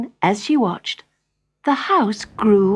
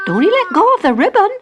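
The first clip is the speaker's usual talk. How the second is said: higher, louder, fast mother's voice; a mother warning her daughter, quick and high; mother telling Jenny not to let go, higher and fast; 12 semitones higher, 7 dB louder, faster than usual. Acted. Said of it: "said her mother".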